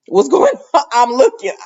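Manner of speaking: high, loud nosy question breaking into a laugh; giggling what's going on. Retold or imitated. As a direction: laugh while being nosy. Cop nosily listening in.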